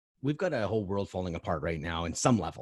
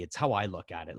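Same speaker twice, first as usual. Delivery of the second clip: usual speaking voice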